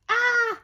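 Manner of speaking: sudden high, loud "ah"; startled cry. Real, not acted. Crafter botching a step on camera, startled cry. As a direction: yelp sharply at a mishap.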